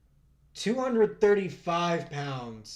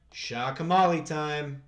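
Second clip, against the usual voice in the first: hype call; 4 dB louder, slower than usual. Acted. Slow hype announcement.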